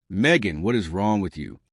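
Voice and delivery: usual speaking voice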